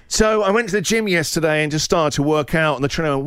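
usual speaking voice

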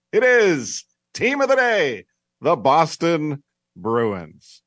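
loud, high segment announcement; announcer fanfare. Acted. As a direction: belt out the segment title like an announcer.

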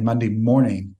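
usual speaking voice

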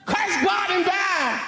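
high, drawn-out wifely outburst; Job's cold wife. Acted. Job's wife urging him to give up, cold wife voice. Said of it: "she's cold".

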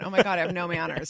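ordinary talk

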